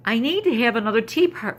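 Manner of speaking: high, loud, hammed-up whine of a little girl; dramatic little girl. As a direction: play the granddaughter's overdone despair, clutching her head. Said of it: "so dramatic, grabs her head and goes".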